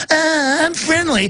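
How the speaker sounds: high, timid mock friendly voice; mock meek gentleman voice; mock friendly liberal. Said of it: "walking around all liberal".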